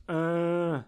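held zombie drone; long, toneless "uh" imitating the undead; zombie groan. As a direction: moan it like a singing zombie. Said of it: "and he goes".

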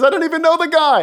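loud, high-pitched mock denial; Peter. Disciple denying his master to a servant, high and loud. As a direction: blurt a panicky denial, hammed up. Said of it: "Peter says".

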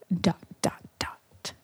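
breathy ominous whisper. Pretend. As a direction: trail off in a hushed whisper. Dramatic teaser ending.

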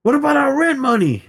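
high, squeaky protest; shocked objection. Retold or imitated. Friend protesting free haircut, high and shocked. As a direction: shriek it in disbelief. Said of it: "friend objects to free haircut".

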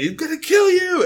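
high-pitched, loud mock scream; Bobby screaming. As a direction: hurl the threat in an imitated teen shriek. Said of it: "Bobby is screaming".

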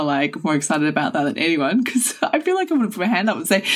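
ordinary talk, nothing marked

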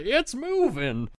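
very high, hammed-up panic; panicked henchman. Henchman seeing the ghost run: high panicked cries. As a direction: cry out high in comic terror. Panicked man voiced by game master.

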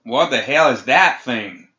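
loud, slow shout of alarm. A narrator's reading. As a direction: yell it back in alarm over the hoofbeats. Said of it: "Samael shouted back".

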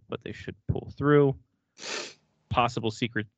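usual speaking voice

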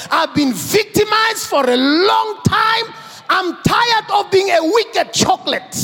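high, loud rallying cry; shouted declaration. Pretend. The believer shouting to their own self, a loud rallying cry. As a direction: shout it like taking back your life. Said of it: "You revive your energy and say".

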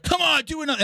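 high, mocking gym coach; a hint of a pushy girlfriend; mock pushy trainer. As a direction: lightly imitate a nagging trainer. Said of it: "she'd be like".

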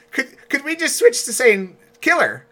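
high, loud mock plea; flustered actor's plea. Actor asking the crew to swap a hard word, high-pitched mock plea. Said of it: "and he, he's like".

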